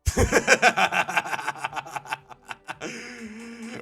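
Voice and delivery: loud, high, long laugh; cracking up. Real, not acted. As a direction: burst out laughing at a joke. Cracks up at the joke.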